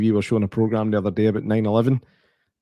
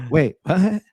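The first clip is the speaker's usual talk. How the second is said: mock cliché line; 8 semitones higher than usual. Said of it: "It's no".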